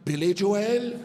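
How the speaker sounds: "Billy Jo-el" offered helpfully; Irish pronunciation. Retold or imitated. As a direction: innocent "Jo-el". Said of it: "You mean, like".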